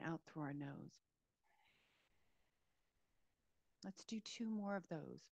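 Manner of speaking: calm, unhurried meditation voice with long pauses; guided meditation. Real, not acted. Yoga teacher easing listeners into a body scan, guided meditation. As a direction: settle listeners gently into a body scan, leaving space between phrases.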